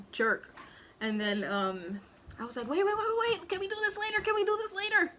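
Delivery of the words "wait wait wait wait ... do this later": high, loud, urgent begging; frantic pleading. Retold or imitated. New mother begs the midwife to wait, frantic. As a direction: plead with her to stop now.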